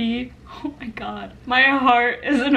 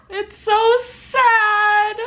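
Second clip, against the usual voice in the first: tearful wail; 7 semitones higher, much slower than usual. Real, not acted. Overwhelmed by sad book.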